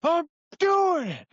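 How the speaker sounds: high, drawn-out mock yell back; stretched-out, high-pitched comeback; exasperated retort. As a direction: imitate a straining teammate protesting, slowly. Rowing partner answering the yelling.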